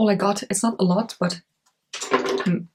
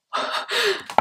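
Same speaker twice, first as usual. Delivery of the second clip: gasp; 12 semitones higher, 5 dB quieter than usual. Real, not acted. Zooming in on palette.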